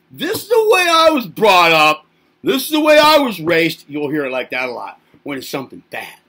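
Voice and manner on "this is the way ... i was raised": high, loud shouting parent; a parent yelling at the kid, high and loud; parent's lecture. Retold or imitated. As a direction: bellow it like a strict parent. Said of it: "Say anything like".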